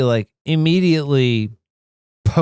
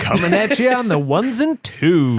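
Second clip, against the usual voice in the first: higher-pitched DJ catchphrase patter; mock radio DJ; 6 semitones higher than usual. Co-host teasing the host with a catchphrase, mock DJ voice. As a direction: play at being a radio DJ.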